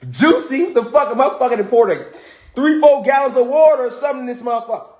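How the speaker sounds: scoffing at the wife's understatement of the juicy pears; incredulous outburst. Real, not acted. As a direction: blurt it out in shock at the juice. Outraged at the juicy pear.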